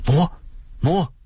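put-on high, eager child voices; children calling. A narrator's reading. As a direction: call mother excitedly. Children calling their mother. (børn kalder ivrigt på mor)

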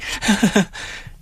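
high laughter breaking out against her will; helpless, unwanted giggle; hysterical laugh. A narrator's reading. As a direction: lose the fight against laughing. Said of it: "Jeg vil ikke le".

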